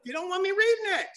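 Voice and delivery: mock indignant. Comic voicing the old guy who won't read it, mock indignant.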